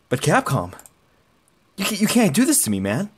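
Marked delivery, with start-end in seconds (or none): 1.8-2.8 high, fast desperate whining; whiny plea. Real, not acted. Begging Capcom for his character.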